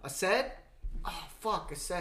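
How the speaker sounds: gasping, loud and high; sudden realization. Real, not acted. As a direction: blurt it out, struck by an idea. Sudden idea of a guest.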